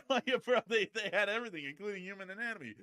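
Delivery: high-pitched, giggling delivery; words riding on a real laugh. Real, not acted. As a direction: crack up at your own joke. Cracking up at own barnyard joke.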